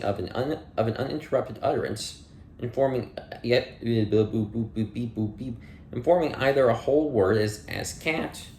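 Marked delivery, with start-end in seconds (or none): 3.8-5.6 playful robotic beep boop noises. Pretend. Filler noises while losing place.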